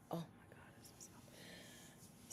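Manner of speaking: whispered, marvelling at the softness; hushed breath of awe; awed whisper. Real, not acted. Showing viewers the whipped butter, in an awed whisper.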